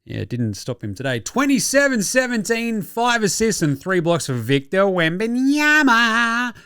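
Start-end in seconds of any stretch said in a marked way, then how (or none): 1.2-6.5 high-pitched announcer stat call, last name stretched out; hyped announcer call. Acted. Podcast host hails Wemby's stat line, hyped announcer call. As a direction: announce it big, drawing out the surname.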